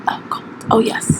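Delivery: whispering. Acted. ASMR segment reaction.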